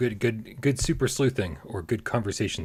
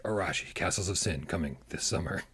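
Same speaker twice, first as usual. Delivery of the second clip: lower, softer trailer voice-over; a hint of movie-trailer narrator, pitched down; mock trailer announcer; 7 semitones lower, 8 dB quieter than usual. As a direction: lightly imitate a game trailer's closing tagline.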